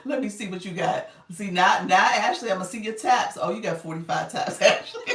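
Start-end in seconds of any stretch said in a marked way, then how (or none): 1.3-5.0 much louder, playful teasing; excited call-out. Real, not acted. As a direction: call Ashley out loudly and with glee. Teasing viewer Ashley loudly.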